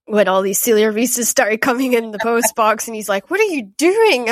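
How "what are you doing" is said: mock-incredulous, high and loud; incredulous husband. Husband to book-buying wife as parcels arrive, mock incredulity. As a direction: imitate a baffled spouse, pitch swooping up. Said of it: "my husband thought I was crazy".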